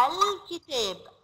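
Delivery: الكتاب said slowly with the lam sounded, al-kitab; slow model word for kids; clear lam. Shown as a demonstration. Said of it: "بنقولها".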